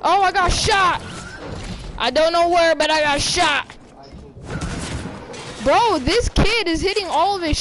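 panicking gamer. Real, not acted. Getting shot in game.

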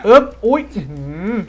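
landing hard, impressed; effortful exclaim. Real, not acted. Jumping down in game.

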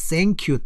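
"thank" begun with S, as "sank you"; common mispronunciation. Shown as a demonstration. Teacher imitates many learners' error, "thank" with an S. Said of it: "저 발음이 많은 사람들이".

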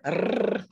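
rrr rolled with the tongue tip; trilled R. Shown as a demonstration. Teacher demoing the Spanish trilled R. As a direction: roll the rrr hard. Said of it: "Spanish has a trilled R".

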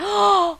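sharp loud gasp of shock. Real, not acted. Player gasping at game twist, loud shocked gasp.

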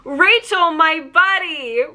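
high-pitched, drawn-out happy hello; excited greeting. Retold or imitated. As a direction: imitate a delighted star greeting a fan. Star greets fan, retold.

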